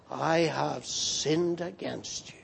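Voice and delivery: low, soft and serious; hushed, weighty admission before the Almighty; solemn confession. Acted. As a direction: confess it gravely, voice dropped. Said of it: "with solemnity and seriousness and with trembling".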